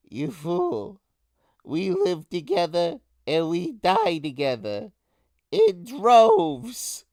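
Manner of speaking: high rat voice building to a shriek; defiant rat king. Acted. Rat king retorting to his enemy, shouting. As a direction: shout it back, peaking on the last words.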